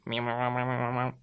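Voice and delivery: low, soft, fast wobbling hum; alien babble noise. A narrator's reading. Alien finishes threatening the Earth, low burbling noise.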